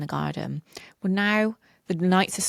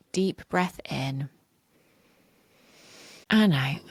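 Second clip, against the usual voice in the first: soft spa whisper. Shown as a demonstration. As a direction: breathe the words out gently, pausing. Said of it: "guide you through a short reflection".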